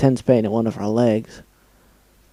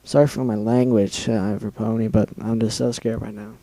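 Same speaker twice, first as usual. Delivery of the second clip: ordinary delivery, nothing marked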